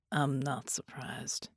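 slow, low, soft murmur. A narrator's reading. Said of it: "he murmured".

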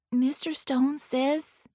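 slow, timid start; a small girl working up courage; nervous child; nervous gulp. A narrator's reading. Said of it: "Penny gulped".

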